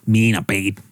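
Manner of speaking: hammed-up accented impersonation; Nanette impression. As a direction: give a mock apology in the accent. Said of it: "Just like Nanette?".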